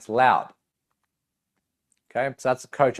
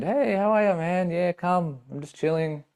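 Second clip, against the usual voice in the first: higher, easygoing buddy greeting; relaxed, pitched-up friendly hello; best friend; 7 semitones higher than usual. Shown as a demonstration. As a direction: greet him like your best mate. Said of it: "best friend".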